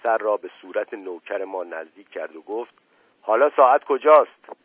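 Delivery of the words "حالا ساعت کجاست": high, forceful question; loud demand. A narrator's reading. Officer right in servant's face, loud demand. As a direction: demand an answer at full voice.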